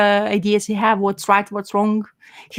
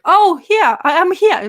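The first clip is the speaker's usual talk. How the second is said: higher, louder, sing-song call of a grandmother; old grandmother; 9 semitones higher, 6 dB louder, slower than usual. Retold or imitated. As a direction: call out cheerily as the missing granny. Grandmother voiced in retold story.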